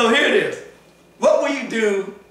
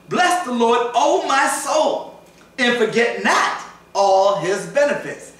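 higher, slow, swelling recital; fervent scripture reading; 7 semitones higher, slower than usual. Acted. Preacher reads verse two aloud, fervent and slow. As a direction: let the verse climb with feeling.

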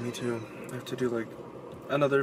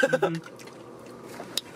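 mukbang moan. Shown as a demonstration. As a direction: savour it with a whispered hum. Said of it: "make those mukbang noises".

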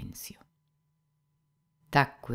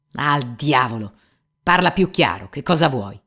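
shouting; 3 semitones higher, 9 dB louder than usual. Acted. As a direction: lose your calm and shout at him. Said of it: "gridò infine Ivan Fiodorovich". (grido furioso, forte e lento)

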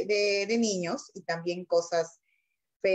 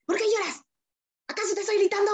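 much higher voice imitating an irritated teacher; mock teacher; 12 semitones higher, faster than usual. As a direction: play the annoyed teacher scolding the crying pupil. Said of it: "la profesora dijo".

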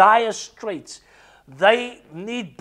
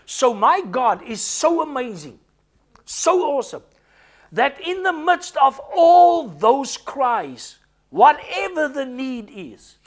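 high, emphatic preacher's proclamation; fervent preaching; 5 semitones higher, slower than usual. Real, not acted. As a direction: proclaim it slowly with soaring conviction. Preaches with fervour.

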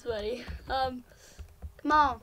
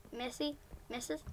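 hushed suspicion; 4 semitones lower, 14 dB quieter than usual. Real, not acted. Creeping near a suspicious player.

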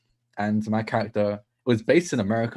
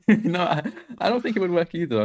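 amused, laughing agreement; higher-pitched, giggly agreement; 7 semitones higher than usual. Real, not acted. Laughing agreement with host's remark.

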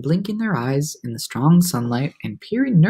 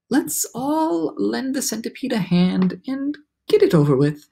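raised storybook ladybug voice; ladybug character; 8 semitones higher than usual. A narrator's reading. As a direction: stretch it out, then settle down. Ladybug urges the others to help.

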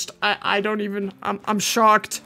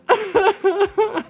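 high, giddy run of laughter; real disbelieving giggle fit; overwhelmed laugh; 7 semitones higher than usual. Real, not acted. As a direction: laugh helplessly, still amazed. Still overwhelmed by the donation.

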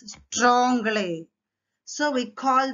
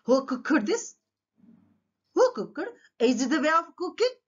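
higher-pitched acted questioning, lifting at the ends; mock interrogation; 5 semitones higher than usual. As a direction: put each question to him pointedly. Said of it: "what are the questions he put to Ramakrishna".

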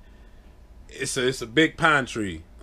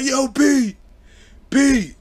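high, loud calling his name; mock yelling across the yard; 13 semitones higher, 6 dB louder, much slower than usual. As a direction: shout after a fleeing friend. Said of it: "We couldn't yell his name".

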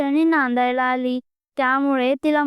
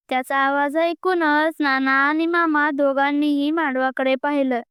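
usual speaking voice

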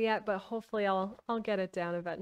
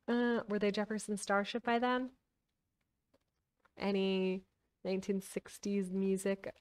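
ordinary delivery

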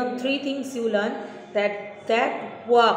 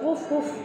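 woof woof like a dog; playful barking for the class; 4 semitones higher than usual. Shown as a demonstration. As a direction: bark like a friendly dog for kids. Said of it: "I can bark like a dog".

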